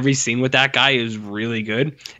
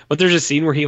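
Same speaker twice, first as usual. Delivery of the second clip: usual speaking voice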